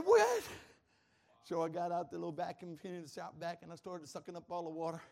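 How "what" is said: high, loud outburst of disbelief; shocked yell. Retold or imitated. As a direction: yelp it in shock at the mess. Retold shock at flooded floor.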